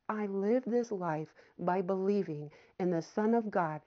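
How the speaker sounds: normal talking voice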